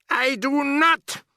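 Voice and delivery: high, slow, loud witch bark; witch shouting. A narrator's reading. Grand High Witch shouting back at grandmother. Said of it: "shouted the Grand High Witch".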